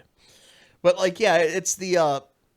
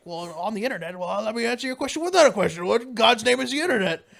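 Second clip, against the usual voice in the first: put-on higher, louder old general bluster; crazy old general; 8 semitones higher, 4 dB louder than usual. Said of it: "that gag of".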